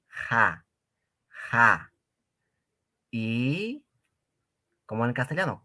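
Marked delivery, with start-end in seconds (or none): 0.1-1.9 "Ja" with a breathy h like a Spanish j; aspirated ja. Shown as a demonstration. Teacher shows the students the h sound, breathy like a j. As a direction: breathe out on "ja" as if blowing air. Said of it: "La H se pronuncia más o menos como la J".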